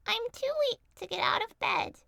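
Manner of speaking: put-on high old woman calling out; frail old grandmother. A narrator's reading. Grandmother calling to the door, high and loud.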